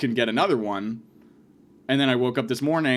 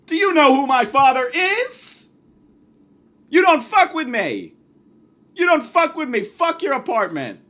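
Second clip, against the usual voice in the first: higher, loud furious yell; heated shouting; 14 semitones higher, 10 dB louder than usual. Retold or imitated. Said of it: "we got a little bit heated".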